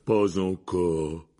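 very slow, crushed and hopeless; dejected. A narrator's reading. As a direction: sigh it out, defeated. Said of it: "dit Hagrid d'un ton accablé".

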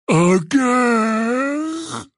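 loud, long drawn-out giant cry; giant's bellow. Acted. Giant calling out to the centaurs below, bellowing. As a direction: bellow a word like a huge simple creature.

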